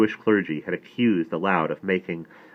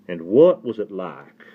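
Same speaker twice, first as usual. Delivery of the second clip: slow, louder Southern drawl; Southern accent; 6 dB louder than usual. A narrator's reading. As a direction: ask it as a courtly Southern rabbi. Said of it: "He said work for work".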